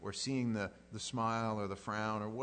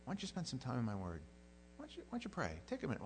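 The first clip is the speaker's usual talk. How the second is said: lightly voiced gentle inner nudge; a slightly higher, softened coaxing voice; little voice; 4 semitones higher, 4 dB quieter, faster than usual. God's little voice nudging the believer to pray, gently coaxing. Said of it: "you just kinda get that little voice".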